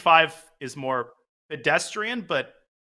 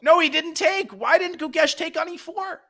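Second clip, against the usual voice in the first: high-pitched, incredulous protest; dismayed disbelief; 11 semitones higher than usual. Real, not acted. Commentator seeing Gukesh miss a capture, dismayed disbelief.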